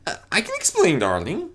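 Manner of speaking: high, nervous posh mare; nervous Rarity voice. A narrator's reading. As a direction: stammer an excuse nervously. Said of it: "Rarity coughed nervously".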